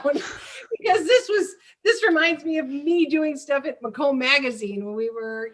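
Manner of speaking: real amused laugh breaking into the line. Real, not acted. Laughing while recalling talk show.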